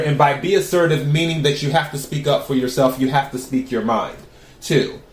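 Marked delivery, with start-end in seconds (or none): none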